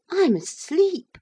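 startled insistence. A narrator's reading. Maurice, turned into a cat, insists he is asleep, startled.

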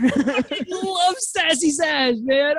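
high, slow, campy drawl; sassy Zsasz voice. Acted. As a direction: blow a kiss, dripping with sass.

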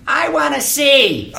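put-on high, loud, slow old lady voice; old lady in audience. Actor imitates the lady in the front row, high and loud. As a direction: squawk it out like a deaf old matinee lady. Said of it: "you hear this lady in the front row say".

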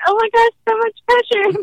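high, hammed-up mock panic; playfully overwhelmed gasp of pressure. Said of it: "And I was like".

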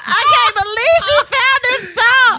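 high, loud, tearful sobbing gush; imitating crying husband. Husband retelling wife's find, mock sobbing loud and high. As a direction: wail it out like overcome with joy. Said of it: "and he starts crying".

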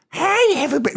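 high sassy drag queen greeting. Retold or imitated. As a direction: chirp it up high like a showgirl. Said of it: "she's just like".

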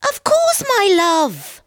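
very high, loud wife voice; high-pitched wife between mouthfuls. A narrator's reading. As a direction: answer in a high singing-out voice while eating. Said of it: "taking another mouthful".

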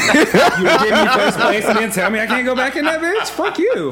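high, loud, laughing mock rant; mock outrage. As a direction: play-act fury while cracking up. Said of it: "I would have been pissed".

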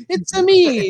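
high, hammed-up Italian accent. Italian Tinkerbell pops out in the movie, hammed-up accent. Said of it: "Tinkerbell that was Italian and it came out".

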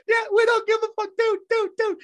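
high, fast, loud yelling voice; rowdy Pittsburgh driver. Retold or imitated. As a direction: shout it like a proud drunk local. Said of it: "He goes, he goes".

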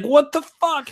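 very high, indignant yelp; outraged older brother. Retold or imitated. Brother furious about getting in trouble, high indignant yelp. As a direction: blurt it in disbelief.

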